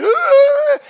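very high, loud crying yell; imitation of a furious child's wail; mock angry wail. Younger brother swinging at the older one, mock angry wail. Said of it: "crying and swinging and just angry".

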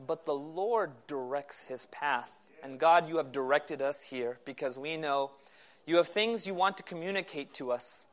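low, hushed, measured praying voice; prayer. Real, not acted. Preacher opening the meeting in prayer to God, low and soft. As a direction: pray softly and reverently, pitched low.

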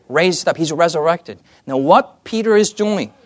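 normal talking voice

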